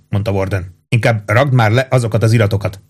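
usual speaking voice

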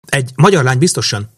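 high, agitated princess's voice; impatient commanding. A narrator's reading. Princess keeps shouting orders at pilot.

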